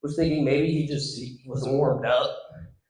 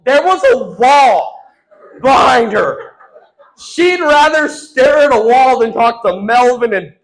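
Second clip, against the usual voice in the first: louder, pitched up, indignant; loud outraged punchline; 9 semitones higher, 11 dB louder than usual. Real, not acted. Comic tells the crowd the girl chose a wall, loud outrage. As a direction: belt it out in comic disbelief. Punchline about humiliating rejection.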